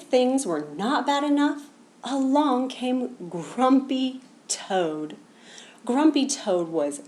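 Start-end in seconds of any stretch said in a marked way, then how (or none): none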